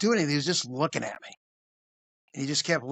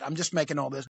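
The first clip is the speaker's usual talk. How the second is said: ordinary talk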